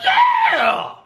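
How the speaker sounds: incredulous falsetto; hammed-up shocked squeak; squeaky disbelief. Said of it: "So I'm like".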